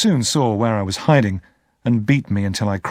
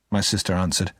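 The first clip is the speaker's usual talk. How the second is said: usual speaking voice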